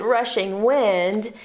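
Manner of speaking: plain reading voice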